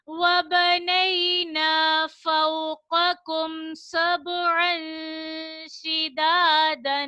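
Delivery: slow melodic tilawat with drawn-out vowels; Quran recitation. Shown as a demonstration. Teacher reciting the verse to the children, slow melodic chant. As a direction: chant the verse slowly like a qari.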